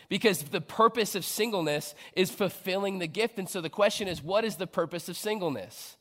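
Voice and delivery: loud, rising preacher's push; full-force preaching. Acted. Preacher urging singles to act, loud and rising. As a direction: drive the point home to the congregation.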